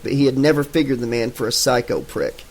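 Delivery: plain reading voice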